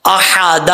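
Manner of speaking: "احد" with squeezed h and long final aa; throaty fine ha. Shown as a demonstration. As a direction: press the h of "احد" from mid-throat. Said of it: "یہ ہا باریک ہے". ("احد" باریک حا کے ساتھ)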